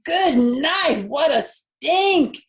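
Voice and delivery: high, loud yells, slow on what a stink; boy yelling in disgust; screaming. Acted. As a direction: shout in disgust and flee. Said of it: "screamed Avery".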